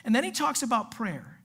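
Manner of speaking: ordinary talk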